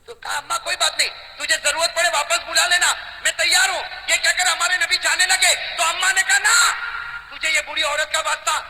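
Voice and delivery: loud, high impassioned sermon voice; rousing storyteller preacher; fiery preaching. Acted. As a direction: preach it out to the crowd, ringing. Preaching the story at full force.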